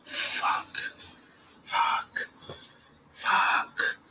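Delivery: soft frustrated whispered curses; whispered swearing. Real, not acted. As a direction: whisper it in defeat. Frustrated muttering after bad news.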